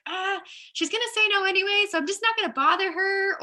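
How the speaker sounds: high-pitched dismissive mock voice; mock excuse-making. Talking yourself out of asking her, high mock excuse-making. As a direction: mimic a self-defeating excuse, pitched well up.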